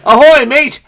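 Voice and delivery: high, loud pirate-style hail; hearty sailor call. Pretend. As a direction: call out ahoy to a mate across the deck. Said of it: "Mate's call".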